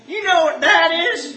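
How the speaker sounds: shouted, high-pitched Arkansas twang; mock an Arkansas woman hollering the question; Arkansas accent. Comic mocking the jar lady, loud Arkansas accent. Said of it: "Nobody should be saying".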